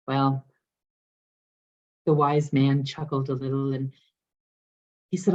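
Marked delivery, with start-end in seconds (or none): none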